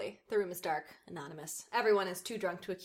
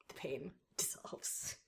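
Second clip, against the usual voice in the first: slow, soft whispered last line; hushed closing line, whispered; whispered ending; 6 semitones lower, 12 dB quieter, slower than usual. Acted. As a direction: breathe it out as the story fades. Dramatic final line.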